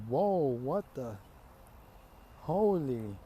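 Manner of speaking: slow, stunned exclamation; drawn-out acted amazement; mock shock. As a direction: imitate a dumbfounded crowd. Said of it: "Everybody's shocked".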